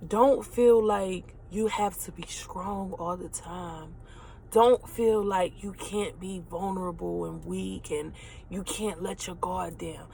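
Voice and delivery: slow, soft, holding back tears; choked up. Real, not acted. Telling listeners it's okay to be weak, choked up. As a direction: speak through a tight throat, slowing down. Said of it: "I'm getting a little choked up".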